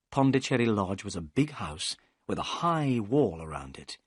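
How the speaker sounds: usual speaking voice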